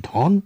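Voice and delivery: ধন said as dhon, closed with no final vowel; hasanta pronunciation. Shown as a demonstration. Teacher showing the standalone word to listeners, ধন ending on n. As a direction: clip ধন short as dhon, nothing after the n. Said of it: "অর্থাৎ উচ্চারণটি হচ্ছে হসন্ত".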